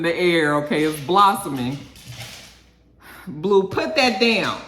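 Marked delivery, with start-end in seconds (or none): 3.3-4.6 raised, drawn-out real command; firm slow telling-off; scolding the dog. Real, not acted. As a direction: scold a pet, higher and louder.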